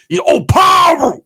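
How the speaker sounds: high, loud, slow shouted power. Acted. Comic hailing tough brothers, high slow shout. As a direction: belt it out like a rally cry.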